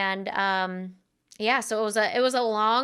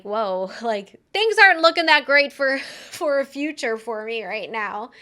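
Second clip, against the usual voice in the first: laughing it off; 3 semitones higher, 6 dB louder than usual. Real, not acted. Laughing at grim memory.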